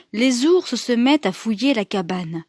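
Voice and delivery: usual speaking voice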